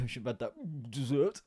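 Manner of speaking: put-on a light, slow old-man grumble; lightly voiced old man regretting his pudding; hint of a creaky old man; windy old man. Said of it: "like a windy old man".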